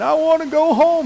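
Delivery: high-pitched mock wail; crying. Daughter phoning home to her parents, mock crying. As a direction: imitate a tearful, whining plea. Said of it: "And she's crying".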